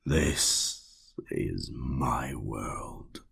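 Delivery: very slow, whispered possession; slow whispered claim. Acted. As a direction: whisper it like a secret triumph. Sinister man claims the world.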